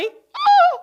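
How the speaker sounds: a very high, loud laughing oh; excited whoop. Real, not acted. Daring the group to hold the vowel, a high laughing whoop. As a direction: whoop with delight.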